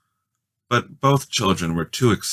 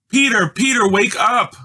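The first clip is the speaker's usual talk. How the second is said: high, loud urgent call to wake him; cried out; 5 dB louder than usual. A narrator's reading. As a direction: shout to wake a sleeping brother. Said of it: "cried Judy".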